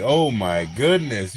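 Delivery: ordinary talk, nothing marked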